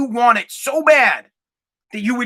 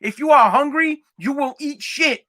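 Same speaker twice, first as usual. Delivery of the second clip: higher, pumped-up, slowing on the last words; fired-up motivation; 6 semitones higher than usual. Shown as a demonstration. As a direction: pump yourself up with it. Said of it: "I'm like".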